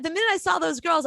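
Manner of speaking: ordinary delivery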